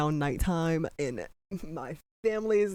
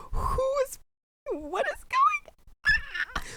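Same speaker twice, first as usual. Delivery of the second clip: very high squeal, drawn out; shrieking disbelief; slower than usual. Real, not acted. Viewer losing it over the show's twist, high shriek. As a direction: shriek it like you can't take it.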